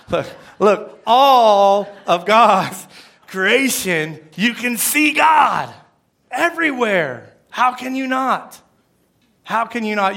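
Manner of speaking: loud, slow, drawn-out pulpit cry; full-force preaching. Acted. Pastor urges his church, loud and slow.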